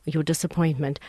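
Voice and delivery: ordinary talk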